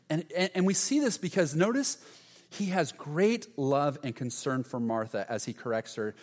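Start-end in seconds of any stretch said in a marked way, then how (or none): none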